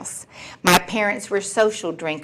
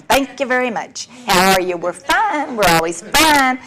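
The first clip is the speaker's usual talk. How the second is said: higher, sing-song "Thank you very much" with a fake bright smile; mock cheerful denial; 5 semitones higher than usual. Quoting the family's cheerful act to the meeting, bright and mock-sweet. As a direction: paper over everything with a chirpy smile.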